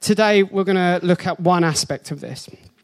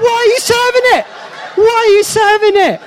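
very high, loud and fast squeal; shrieking disbelief; 13 semitones higher, 7 dB louder than usual. Retold or imitated. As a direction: screech it in outraged disbelief. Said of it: "I was like".

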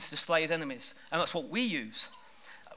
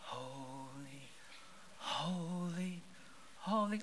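dull, droning holy; 2 semitones lower, 16 dB quieter, much slower than usual. Retold or imitated. Preacher mocking a sleepy, soft church hymn. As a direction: drone it with no wonder. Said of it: "They're not going".

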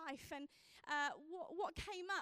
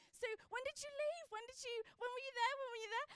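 higher, quick-fire eager questions; eager questioning; 7 semitones higher than usual. Retold or imitated. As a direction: imitate him anxiously pumping her for dates. Fiancé eagerly quizzing the teacher.

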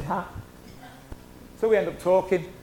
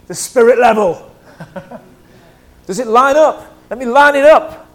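lively emphasis; 3 semitones higher, 11 dB louder than usual. Real, not acted. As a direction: sell the image with energy. Playful spirit level image.

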